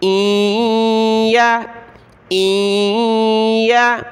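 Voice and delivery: इया drawn very slow; joined hamza and ya. Shown as a demonstration. Teacher drills the joined syllable slowly. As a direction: glide the hamza into the ya. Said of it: "हमज़ा को या के साथ मिलाएंगे".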